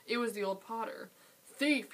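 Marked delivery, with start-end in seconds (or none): 1.5-1.9 sudden high shout of Thief; screamed accusation. Acted. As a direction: scream it at the intruder. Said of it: "he screamed".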